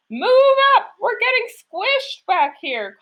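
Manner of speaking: high, loud call, then slow; squished baker calling forward, pitched high. A narrator's reading. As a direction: call out from the squashed back. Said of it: "called the baker".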